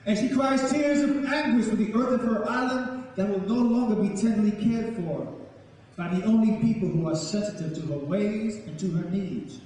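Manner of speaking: normal talking voice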